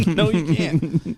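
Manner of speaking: high, loud, slow laughing refusal; amused mock protest; laughing retort. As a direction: draw it out through laughter. Repeats retort while laughing.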